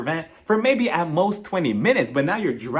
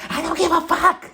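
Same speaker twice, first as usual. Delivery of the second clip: mock shriek; 11 semitones higher than usual. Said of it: "Kyle was like".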